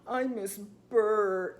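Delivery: slow, drawn-out sulky whine; lightly voiced pouting boy; mock pouting man. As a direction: imitate a sulking grown man, slowly. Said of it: "like, pouting".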